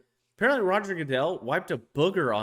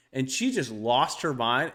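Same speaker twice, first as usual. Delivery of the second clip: normal talking voice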